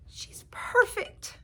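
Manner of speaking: a gentle mother, drawn out; mother's voice. A narrator's reading. Mother voiced in read-aloud story.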